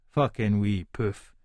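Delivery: plain reading voice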